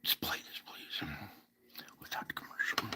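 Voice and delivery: soft unvoiced whisper; whispered off-mic request. Real, not acted. Host quietly asking his producer to roll the clip, whispering. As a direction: murmur it aside so the audience barely hears.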